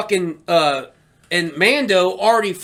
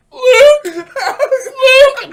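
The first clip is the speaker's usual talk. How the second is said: much higher and louder, crying out the name; tearful cry; 8 dB louder than usual. Retold or imitated. Reliving seeing Luke on screen, crying out tearfully. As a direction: sob the name in joy. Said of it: "I'm starting to cry".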